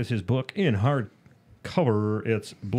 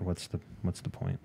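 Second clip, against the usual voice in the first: whisper; 3 semitones lower, 10 dB quieter than usual. Real, not acted. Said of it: "questioning person".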